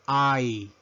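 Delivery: letter I pronounced "ai"; English letter I. Shown as a demonstration. Teacher modelling the English alphabet, I as "ai". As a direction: say I as the English "eye". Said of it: "La letra I".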